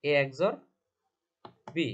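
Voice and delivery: উচ্চারণ দেখিয়ে "এ এক্স or বি"; spelling out the name. Shown as a demonstration. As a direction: say "এ এক্স or" loudly and clearly as "e eks-or", then "বি" on its own. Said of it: "এটাকে আমরা বলবো".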